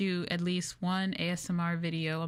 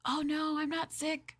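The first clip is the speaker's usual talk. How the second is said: high, quick protest; surprised denial; 9 semitones higher than usual. Real, not acted. Streamer reassuring chat she's not sick, high and surprised. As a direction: bat away the worry, pitched high.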